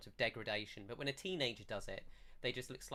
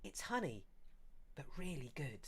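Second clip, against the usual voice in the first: lightly voiced villager, high start dropping to a hush; villager offering a drink; 4 semitones higher, 6 dB quieter than usual. Villager handing a horn of mead to visitors, softly reassuring. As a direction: confide it gently, starting high. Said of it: "she hands it out to you and goes".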